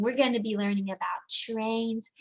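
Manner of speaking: ordinary delivery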